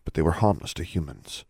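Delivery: ordinary delivery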